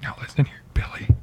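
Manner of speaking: low menacing whisper; threatening whisper. Acted. Seth Rogen threatening Billy in a whisper. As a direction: hiss it like a threat. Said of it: "You don't need to whisper too".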